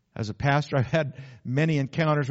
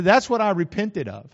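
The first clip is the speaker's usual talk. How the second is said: firm declaration; 6 semitones higher, 6 dB louder than usual. Retold or imitated. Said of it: "she said".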